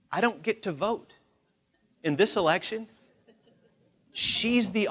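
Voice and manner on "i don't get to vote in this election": ordinary talk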